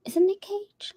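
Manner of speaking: whispered doubtful question to herself. Real, not acted. Unsure of a celebrity's name, whispering to herself. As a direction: ask yourself in a whisper.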